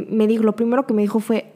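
normal talking voice

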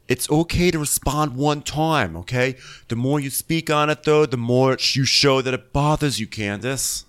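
hammed-up attempt at someone else's accent; terrible Chris accent. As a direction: put on a bad accent, measured and scolding. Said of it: "It's my terrible Chris accent".

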